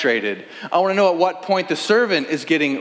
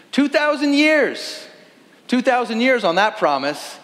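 incredulous; 10 semitones higher than usual. Acted. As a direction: throw out the number in disbelief. Exasperated outburst.

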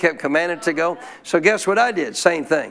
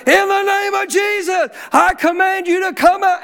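commanding shout; 13 semitones higher, 4 dB louder than usual. Retold or imitated. Pastor commanding the demon out, shouting high. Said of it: "And I'm saying".

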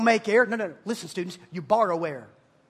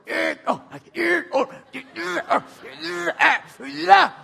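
strained grunts, pitch jumping up, laughing; straining grunts; 6 semitones higher, 4 dB louder, slower than usual. Retold or imitated. Preacher mocks making your own heartbeat, strained laughing grunts. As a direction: grunt like you're forcing a heartbeat. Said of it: "sitting there going".

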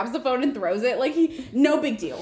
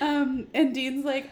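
ordinary delivery

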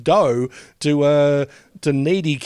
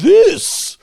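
slow, much higher, squeaky mock voice; mimicking the shop guy; 6 dB louder, much slower than usual. Podcaster mimicking the toy shop clerk, high and slow with laughter. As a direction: put on the toy shop guy's funny voice while laughing. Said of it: "who talks like that".

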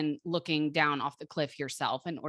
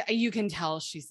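normal talking voice

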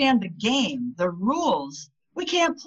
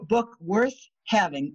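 ordinary talk, nothing marked

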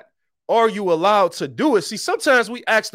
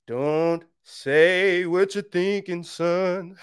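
slow self-warning; much slower than usual. Real, not acted. Preacher warning himself not to say it, slow. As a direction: drag it out as a warning to yourself. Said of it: "I'm not gonna say it".

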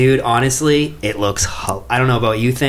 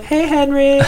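squeaky cartoon Hitler, laughing through it; giggling high mock Hitler; high goofy Hitler voice; 3 dB louder than usual. Hitler voiced in retold trailer.

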